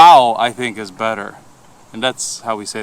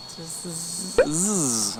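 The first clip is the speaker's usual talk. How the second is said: Italian buzz zzz; 4 semitones higher, 5 dB quieter than usual. Shown as a demonstration. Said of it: "In Italian".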